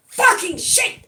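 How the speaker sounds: loud, high yelp of a curse; pained curse. Acted. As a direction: yell it as the crab bites. Crab pinches [name]'s finger.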